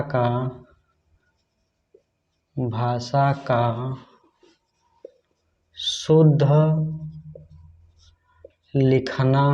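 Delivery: word-by-word dictation with long pauses; teacher spacing out each phrase. Real, not acted. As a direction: dictate slowly for pupils writing it down, piece by piece.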